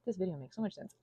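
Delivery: ordinary talk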